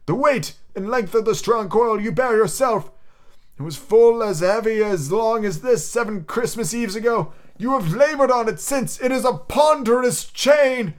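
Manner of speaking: high, slow, solemn ghost of Marley. Acted. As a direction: intone it like a chained spirit.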